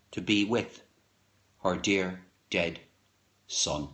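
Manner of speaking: slow, dropping close of the poem; fading last line. Acted. Reader ending the poem on the dead son, trailing off. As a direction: let it sink away into silence.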